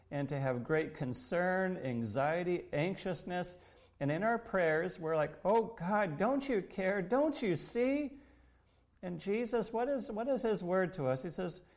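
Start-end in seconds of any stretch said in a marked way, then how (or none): 5.4-8.2 higher, pleading questions; imitating a distressed prayer; anxious plea. Worried believers praying to God, anxious plea.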